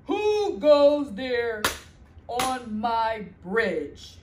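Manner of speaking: loud, slow troll boom. Acted. Troll guarding his bridge, booming slowly. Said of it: "the troll underneath said".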